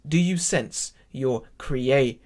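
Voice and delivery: slow, with "creator" broken into punched syllables; really emphatic. Shown as a demonstration. Teacher translates the line for choir, emphatic slow "creator". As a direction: punch "sense", separate out "creator". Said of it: "Really, uh, emphatic".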